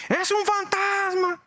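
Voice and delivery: high, slow, frightened shout of a ghost again; retelling the disciples' scream to the congregation; terrified cry. Acted.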